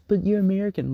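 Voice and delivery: high-pitched mock protest; mock objection. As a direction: parody an objector, pitched well up. Mocks an imagined objector.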